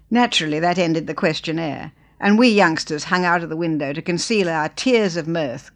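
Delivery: usual speaking voice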